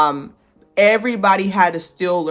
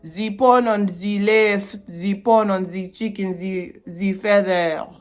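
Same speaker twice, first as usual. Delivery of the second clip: slow, hammed-up French accent; mock-French, stretching the words; exaggerated French accent; 2 semitones higher, slower than usual. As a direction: overdo a cartoonish French accent, drawn out. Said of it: "more of a French accent, like, not".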